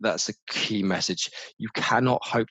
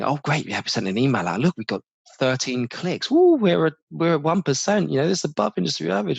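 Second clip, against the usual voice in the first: mock excitement; 8 semitones higher, 4 dB louder than usual. Mocking peers' excited metrics boast.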